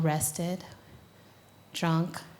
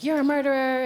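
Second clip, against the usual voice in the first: louder, higher retold accusation; teen daughter accusing military father, higher and louder; 6 semitones higher, 5 dB louder than usual. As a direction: fling it at your father as a young activist. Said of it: "I turned to my father and I was just like".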